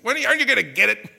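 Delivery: high, loud, fast exasperated yell; imitated yell at kids. Father yelling at his kids, loud and fast. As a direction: parent yelling at kids who won't learn. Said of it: "I used to yell at my kids".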